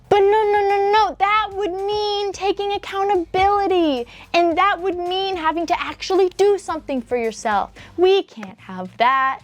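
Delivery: mock the entitled view with heavy sarcasm; sarcastic mockery.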